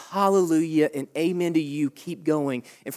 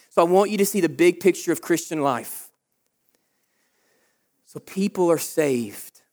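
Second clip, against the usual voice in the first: usual speaking voice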